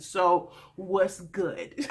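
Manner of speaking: lässig gedehnt, sehr langsam; slow, drawn-out challenge. Retold or imitated. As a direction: stretch it out slowly, cocky. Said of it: "He said".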